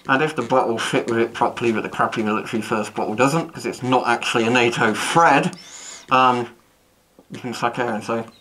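muffled voice inside respirator; speaking behind mask filter; talking through a gas mask. Real, not acted. Seen 3: full-face respirator worn throughout; R frames show bare face.

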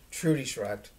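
usual speaking voice

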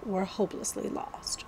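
hushed whisper. A narrator's reading. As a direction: breathe the words out softly. Narration drops to whisper.

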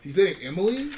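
high loud disbelieving question; surprised. Real, not acted. Hearing a friend is dating Emily, shocked. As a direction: gasp it out like juicy news.

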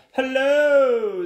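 high, loud, long-drawn hello; greeting squid. Acted. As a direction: sing out a big cartoon greeting. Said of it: "said a squid".

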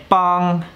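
'bang' held high and level; wrong tone. Shown as a demonstration. Teacher showing students what not to say, wrong high tone. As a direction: keep 'bang' up high without falling. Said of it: "very important, the tone".

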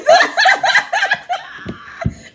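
high-pitched burst of laughter. Real, not acted. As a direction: crack up. Laughing at own story.

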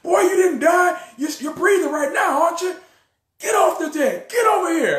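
high, loud drill-sergeant yell; mock yell. Commander yelling at the recruit to get off the deck. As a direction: bark at the crying recruit. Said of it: "why you yelling".

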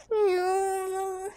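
soft whiny wailed "no"; drawn-out tearful whine; crying. Acted. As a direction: wail it out like a fussing baby. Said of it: "Don't cry".

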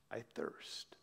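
hoarse, very soft whisper from a dry throat; raspy whisper. Acted. Jesus on the cross, parched, a soft raspy whisper. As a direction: barely voice it, parched and spent. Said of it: "In a raspy voice, he whispers out".

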